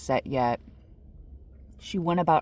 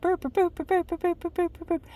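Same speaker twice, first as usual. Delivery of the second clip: much higher, rapid burps like a blaring alarm; imitated car alarm; faster than usual. Leaning on the horn at 3 AM, high alarm burps. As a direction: blast it like a car horn held down.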